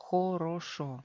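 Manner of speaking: хорошо read with three clear O's; official spelling pronunciation. Shown as a demonstration. Teacher repeating the official reading, spelled-out pronunciation. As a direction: pronounce every O in хорошо as written. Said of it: "Oficialmente se lee хорошо".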